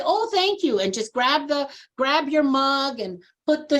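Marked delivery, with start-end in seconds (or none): 0.0-0.8 polite acceptance. Pretend. Guest accepting offered coffee politely.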